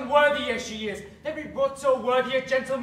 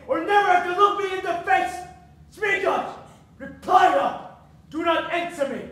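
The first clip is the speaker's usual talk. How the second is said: furious; 4 semitones higher, 4 dB louder than usual. Acted. Father curses daughter.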